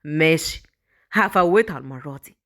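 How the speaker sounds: low, slow menace; muttered threat. Acted. Villain threatens after she flees. (تهديد منخفض وبطيء)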